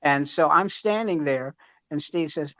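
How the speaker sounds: ordinary delivery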